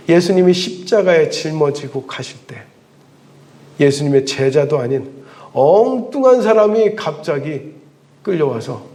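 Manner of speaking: usual speaking voice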